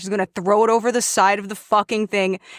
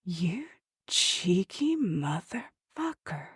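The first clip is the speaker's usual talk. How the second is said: hushed disbelief; 2 semitones lower, 11 dB quieter, much slower than usual. Acted. Thea staring at the idol, hushed and slow. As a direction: breathe it in slow amazement. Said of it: "and go".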